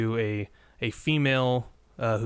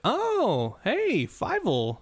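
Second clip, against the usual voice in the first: high, drawn-out "oh, hey" of recognition; pleasantly surprised, very high-pitched; delighted recognition; 11 semitones higher, much slower than usual. Retold or imitated. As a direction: act out spotting a familiar favorite, voice swooping up high. Reenacts his delighted recognition.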